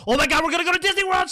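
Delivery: loud, high, rushed squeal; hammed-up shriek of joy; imitated excited child. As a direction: burst out like a kid who just got huge news. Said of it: "She's excited".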